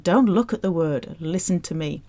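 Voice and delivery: ordinary talk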